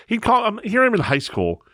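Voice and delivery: ordinary talk, nothing marked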